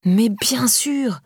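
drawn-out, high dawning "of course"; exclaimed realisation. A narrator's reading. Laura suddenly understanding who the woman is, exclaiming. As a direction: stretch it slowly as it all clicks, falling at the end. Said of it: "s'exclama Laura".

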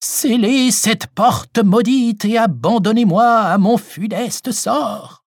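slow high ghostly lament; voice from beyond the grave. Acted. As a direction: drag it out like a ghost. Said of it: "d'une voix d'outre-tombe".

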